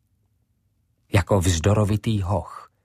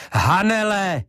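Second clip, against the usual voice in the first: much higher, louder shout of her name; full-voiced shout; 12 semitones higher, 5 dB louder than usual. A narrator's reading. Ivo shouting for Hanele at full voice. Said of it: "křičel plným hlasem".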